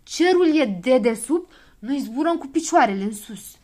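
shouting in panic, slowing at the start; loud alarmed cry. A narrator's reading. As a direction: yell the alarm. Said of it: "începu el să răgnească".

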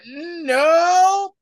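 high, loud cry of no; sharp mock shout. Maria Hill snapping at Fury, high loud no. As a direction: shout it down hard. Maria Hill's angry denial.

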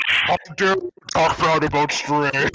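distorted demon voice; warped voice effect; demon voice mod. Real, not acted. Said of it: "you sounded like an actual demon".

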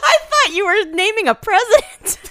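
high, loud, helpless laughter through the words; shrieking with laughter; laughing hard. Real, not acted. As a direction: squeal it out while cracking up. Cracking up over the mix-up.

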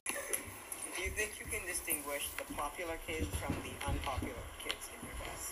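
tinny phone recording; recorded on a phone; low quality stream. Real, not acted. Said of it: "Sorry about the bad quality of the audio".

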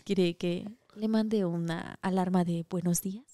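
soft, low teasing offer; hotline girl voice. Pretend. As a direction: lure him like a hotline girl. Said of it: "[name] hace voz de chica hotline".

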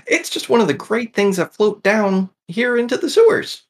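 high-voiced creature explaining its treasure; chatty sewer creature. Sewer creature tells a visitor about found books, high-voiced. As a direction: pipe it up high as a little sewer dweller.

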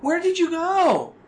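put-on high, plaintive girl's voice; a kid asking where he went; young girl.

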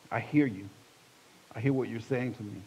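soft, submissive address to God; quiet prayer. Retold or imitated. Man praying to the Lord while driving, quiet surrender. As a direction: answer God gently, with pauses.

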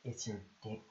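low, soft seductive voice; sexy voice. Pretend. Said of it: "what's your best sexy voice?".

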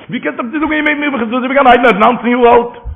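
high-pitched, emphatic disbelief; incredulous question. Retold or imitated. Preacher repeating the question about the young grandson, high incredulous protest.

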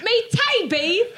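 British accent. Shown as a demonstration. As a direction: draw it out in a high British accent. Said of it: "that's British accent".

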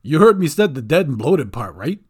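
loud incredulous goblin; appalled goblin protest. A narrator's reading.

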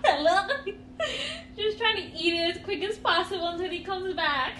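giggling, a bit high; laughing. Real, not acted. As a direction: laugh through the whole story bit. Laughing at her own antics.

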